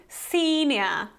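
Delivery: "senior" with a smiling "ee"; smiling long E. Shown as a demonstration. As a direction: spread the lips for a long "SEE-nyuh" in "senior". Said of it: "So you're kind of smiling".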